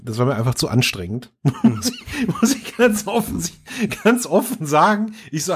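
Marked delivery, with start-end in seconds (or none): 1.6-5.1 laughing. Real, not acted. Laughing while admitting.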